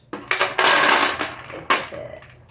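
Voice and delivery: loud unvoiced laugh; loud breathy laugh. Real, not acted. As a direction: burst out laughing. Laughing at the sound.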